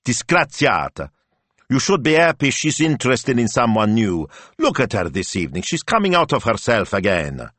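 high, exasperated wifely scolding; scolding snap. A narrator's reading. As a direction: tell off your husband sharply. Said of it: "Betta snapped".

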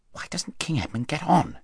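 high, whispery impatient question; soft, restless whisper before a battle; hushed impatience. A narrator's reading. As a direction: fret under the breath. Corin waiting, whispering before battle.

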